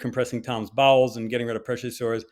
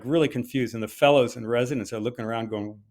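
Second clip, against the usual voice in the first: usual speaking voice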